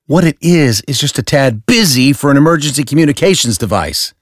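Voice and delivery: high, loud, sneering critique; disgusted Dent. Acted. Dent sneering at the bat signal, high and disgusted. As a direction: pick the design apart with disgust.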